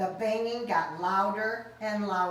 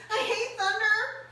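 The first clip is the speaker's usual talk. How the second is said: whimper; 13 semitones higher, 4 dB louder than usual. Acted. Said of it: "he whimpered".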